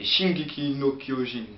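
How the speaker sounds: put-on Japanese accent, drawn out slowly; slow, exaggerated Japanese-accented title. Shown as a demonstration. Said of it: "j'ai fait super bien l'accent japonais".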